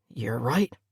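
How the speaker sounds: rough and low, barely voiced; a hoarse, quiet concession. A narrator's reading. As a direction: admit it hoarsely. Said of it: "he said hoarsely".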